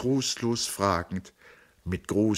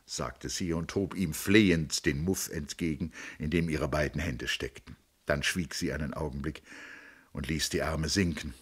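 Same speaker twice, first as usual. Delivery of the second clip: ordinary delivery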